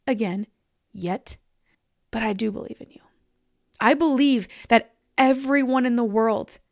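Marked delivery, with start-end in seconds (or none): 0.9-3.0 whispered. Real, not acted. Repeated line, whispered take.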